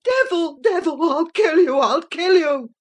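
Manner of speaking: frantic, powerless fury; mild shriek. A narrator's reading. Boy shaking the locked door, shrieking in powerless fury. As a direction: spit out the threat in a half-shrieked rage. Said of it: "he rather shrieked than said".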